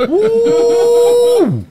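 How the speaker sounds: very high, loud whoop; crowd whoop. Retold or imitated. Comedian imitating the crowd cheering his burn.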